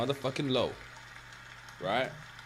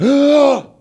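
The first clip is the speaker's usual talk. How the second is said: sharp high loud gasp of fright; retold shocked gasp, loud and high; startled gasp; 19 dB louder than usual. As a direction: suck in air as if woken by jets. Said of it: "I grabbed my breath".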